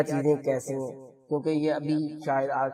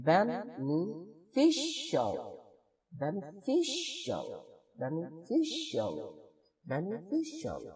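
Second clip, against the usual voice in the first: Beneficial slow and softer, third syllable stressed; slow stress drill; 3 semitones higher, 4 dB quieter, much slower than usual. Shown as a demonstration. Teacher drilling students on beneficial, slow and soft. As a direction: repeat beneficial slowly, leaning on the third syllable. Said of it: "فی الحال یہ دیکھیں".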